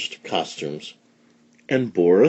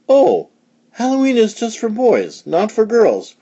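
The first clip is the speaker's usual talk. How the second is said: put-on childlike high voice; 13 semitones higher than usual. A narrator's reading. Morris voiced by reader.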